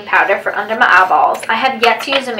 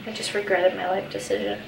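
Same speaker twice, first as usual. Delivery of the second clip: normal talking voice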